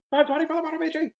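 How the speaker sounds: high, fast hype announcer call; fighting game announcer. Acted. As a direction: boom out the bid like an arena announcer. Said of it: "do you have a good announcer voice".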